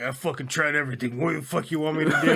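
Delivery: host imitating the cook losing patience; mock exasperation. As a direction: vent like a fed-up cook.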